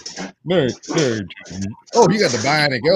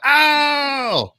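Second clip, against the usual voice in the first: excited gasp. Retold or imitated. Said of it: "And I was like".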